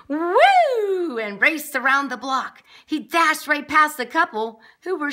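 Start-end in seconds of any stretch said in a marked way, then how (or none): 0.1-1.2 high, loud, long "woo"; gleeful drawn-out holler; hollered whoop. A narrator's reading. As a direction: whoop with wild joy. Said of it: "leaped and hollered".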